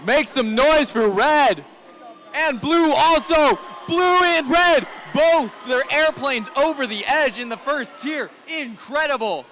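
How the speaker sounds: high, slow crowd hype shout; dueling hype announcers. Real, not acted. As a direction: pump up the crowd. Hyping the crowd at end.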